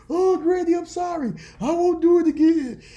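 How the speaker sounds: very high, loud mock wail; pleading child. Grandson begging Granny in the counselor's office, hammed-up high-pitched pleading. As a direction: beg like a kid getting whooped.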